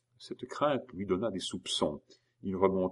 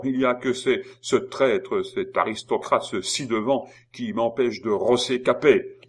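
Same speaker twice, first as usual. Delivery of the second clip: loud, raised voice, spitting each insult; angry accusation; 5 semitones higher, 7 dB louder than usual. Acted. Simon denouncing Lorrain to Maurice, in an angry loud voice. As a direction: accuse him hotly, voice up and loud.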